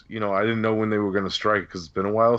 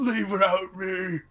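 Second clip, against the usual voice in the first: mock plea; 10 semitones higher, slower than usual. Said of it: "He's like".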